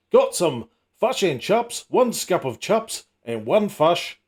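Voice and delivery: put-on a slow, mild Kiwi accent saying "chups". A New Zealander ordering at Bondi Beach, mild slow Kiwi accent. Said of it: "Got a quizzical look".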